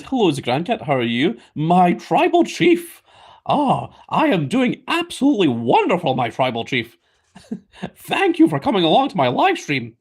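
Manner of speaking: hammed-up wrestling manager; Paul Heyman impression. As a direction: schmooze like a wrestling manager praising his chief. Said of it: "That's my wise man impression of, uh, big old Paul Heyman".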